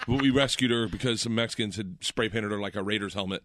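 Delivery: ordinary delivery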